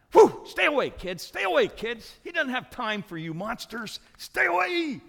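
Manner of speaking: put-on loud, high-pitched shouts to shoo kids off; acted scolding shout; gruff shooing away. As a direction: yell to drive the pesky children back. Disciples shooing children in retold story.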